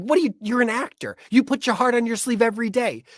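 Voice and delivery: high, pushy scolding wife voice; wife scolding her husband to try piano, high and insistent; berating wife. Retold or imitated. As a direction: push him hard, half yelling. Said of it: "she berates me".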